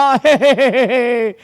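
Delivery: joyful goat laugh; delighted laugh. Acted. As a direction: laugh out with glee. Goat showing off jumps. (துள்ளலான சந்தோஷ சிரிப்பு)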